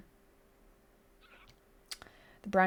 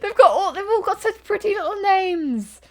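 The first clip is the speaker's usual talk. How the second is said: laughing protest; 10 semitones higher, 7 dB louder than usual. Real, not acted. Laughing protest about pretty names.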